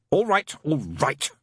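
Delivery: high, slow, irritated cut-in; snapped. A narrator's reading. Crowley cutting off Aziraphale's praise, snapping. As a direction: snap it to stop the praise. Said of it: "Crowley snapped".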